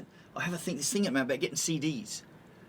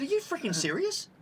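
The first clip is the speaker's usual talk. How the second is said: high-pitched incredulous retort; 11 semitones higher than usual. Retold or imitated. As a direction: replay your disbelief, pitch leaping up. Retold quarrel with flight attendant.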